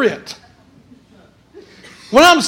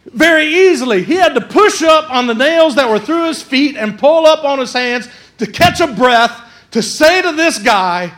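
fiery preaching; 5 semitones higher, 4 dB louder than usual. Acted. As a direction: drive it home to the congregation. Preaching at full force.

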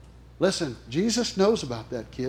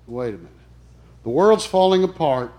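usual speaking voice

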